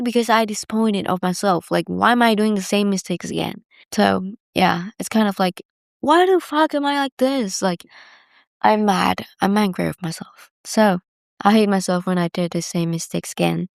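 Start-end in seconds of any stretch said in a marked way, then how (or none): 6.0-7.6 high-pitched frustrated self-reproach; exasperated with oneself. Retold or imitated. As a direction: voice your frustration at yourself, pitch jumping up. Voicing anger at herself.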